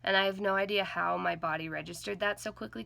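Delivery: usual speaking voice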